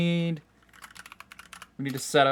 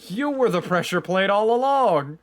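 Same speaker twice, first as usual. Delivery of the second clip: dramatic reveal; 6 semitones higher, 6 dB louder than usual. Pretend. Mock dramatic twist reveal.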